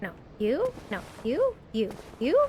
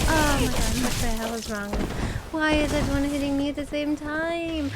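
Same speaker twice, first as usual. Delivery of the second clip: ordinary delivery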